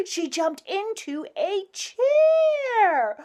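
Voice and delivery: lively, rising story-reading voice; reading to children. Acted. Reading rhyming story aloud.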